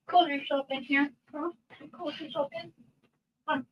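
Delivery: high soft pet voice; talking to a dog. Real, not acted.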